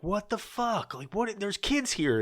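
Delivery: high, outraged mock passerby; shocked passersby. Mimicking appalled parents walking by the stage, high and shocked. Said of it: "they're like".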